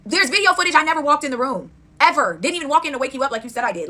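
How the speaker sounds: loud angry denial. Real, not acted. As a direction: yell it at her, furious. Angry rant at ex-friend.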